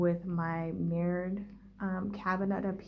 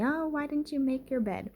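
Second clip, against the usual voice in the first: high-pitched mock scolding mom; imitating mom yelling; 8 semitones higher than usual. Mom scolding her kid over an unmade bed, mock yell. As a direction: put on a mother's nagging yell, pitched high without real volume. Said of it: "my mom would be yelling at me".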